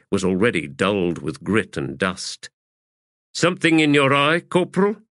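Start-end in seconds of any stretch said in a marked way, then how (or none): 3.3-5.0 pointed questioning. Acted. Officer questions a tearful soldier.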